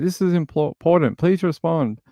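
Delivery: hammed-up pushy demand; mock urgent plea. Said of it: "Like, this is how she types".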